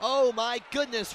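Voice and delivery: usual speaking voice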